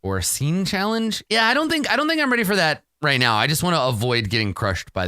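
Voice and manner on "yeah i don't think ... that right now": ordinary talk, nothing marked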